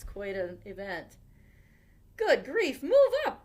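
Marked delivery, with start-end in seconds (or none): 2.2-3.4 high, loud exasperated yell. A narrator's reading. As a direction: shout it at the baker. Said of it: "yelled Grandpa Dan".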